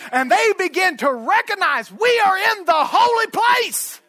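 high, loud, building to a shout; full-force preaching. Real, not acted. Preacher declaring holy ground to the congregation, building shout.

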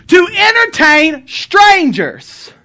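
"To entertain strangers" shouted slow and high; calling out the verse to the church; preached shout. Shown as a demonstration. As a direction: proclaim "To entertain strangers" loudly, drawn out. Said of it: "what does it say".

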